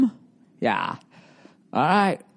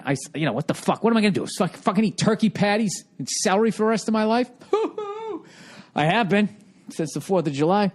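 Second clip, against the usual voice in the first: ordinary delivery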